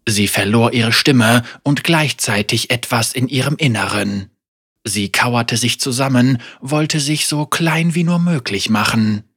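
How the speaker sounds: usual speaking voice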